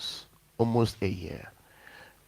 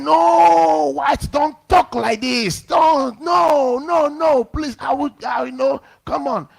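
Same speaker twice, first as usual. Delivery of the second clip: much higher, shouted begging; loud high panicked plea to the pastor; frantic pleading; 12 semitones higher, 4 dB louder, slower than usual. Retold or imitated. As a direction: cry out desperate not to be exposed. Debtor pleading to be spared.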